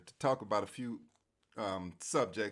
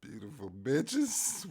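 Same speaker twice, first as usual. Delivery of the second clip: sultry voice; 5 semitones higher, 5 dB louder, much slower than usual. Pretend. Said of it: "I have a nice sultry voice".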